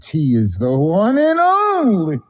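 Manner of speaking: high, drawn-out cry with a long held note; mock-preacher proclamation. Caller proclaiming at the store clerk, hammed-up preacher cry. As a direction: stretch it skyward like a hammed-up preacher.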